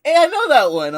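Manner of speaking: high, loud retold excitement; excited recognition. Manager recognizes anime, retold.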